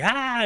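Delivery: high-pitched mock scream; short, high imitation scream; imitated doll scream. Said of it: "And he's screaming".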